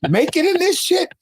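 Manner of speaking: mock disbelief, climbing very high on the question; incredulous father. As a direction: imitate a scoffing dad, pitch leaping up at the end. Acting out his doubting father.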